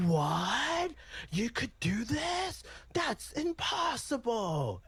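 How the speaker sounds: shrill, high, amazed anime bystander; overacted anime dub. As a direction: overact the stunned crowd in a cheesy dub. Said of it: "that's what you said about".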